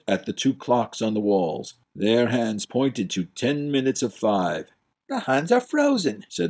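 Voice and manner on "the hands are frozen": put-on puzzled young prince. Prince baffled at the Duke's empty gesture. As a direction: blurt it out, baffled.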